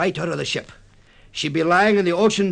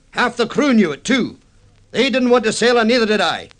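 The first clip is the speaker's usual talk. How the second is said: louder and higher, building to an accusing shout; rising anger; 7 semitones higher, 6 dB louder than usual. Acted. Captain rounding on the shipowner, in a raised angry voice. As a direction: hammer the accusation home as the anger boils over.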